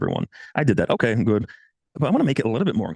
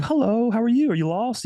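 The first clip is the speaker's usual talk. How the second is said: high, teasing mock-girly voice; light mock girls' voice; imitating giggly women; 13 semitones higher than usual. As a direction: play the amused women, pitched up. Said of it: "giggling and laughing and going".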